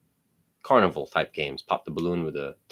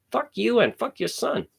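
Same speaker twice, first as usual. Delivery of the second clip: retold cursing. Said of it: "I'm cursing at this fucking lady".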